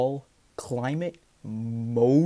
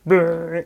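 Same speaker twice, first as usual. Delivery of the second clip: loud rolled brrr like a truck engine; truck rumble; 4 semitones higher, 8 dB louder than usual. Retold or imitated. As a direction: growl it out like a passing truck. Imitating a passing truck.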